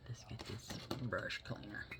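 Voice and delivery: soft whisper, busy with the brush; whispered aside. Real, not acted. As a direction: breathe it out while cleaning the brush. Murmured aside while working.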